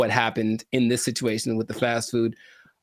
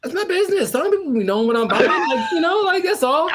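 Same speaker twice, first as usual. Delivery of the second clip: much higher, laughing mock complaint; mock indignant; 11 semitones higher than usual. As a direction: scold the robot in a squeaky huff. Mock complaint to robot checkout.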